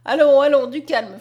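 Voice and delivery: loud first words, then easing down; brisk calming command. A narrator's reading. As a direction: cut in firmly to settle someone. Doctor checks the excited patient.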